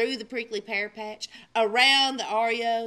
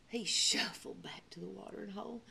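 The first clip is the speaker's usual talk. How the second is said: weary whisper; 4 semitones lower, 12 dB quieter, faster than usual. A narrator's reading. Tired storytelling.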